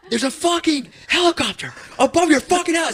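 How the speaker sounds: very high, frantic re-enacted panic; retold freaking out. Caller warning Ilya about the chopper, freaking out. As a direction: squeal the alarm, half laughing. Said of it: "I was freaking out".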